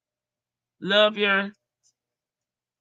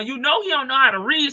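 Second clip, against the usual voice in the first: ordinary talk, nothing marked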